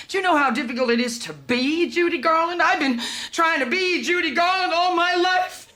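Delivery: high, loud retort, building; exasperated outburst. Acted. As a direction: throw it back, rising to an anguished cry. Throwing the complaint back, outburst.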